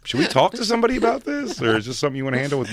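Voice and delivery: high, hammed-up worried voice; mock concerned friend. Teasing Christine with a mock concerned high voice.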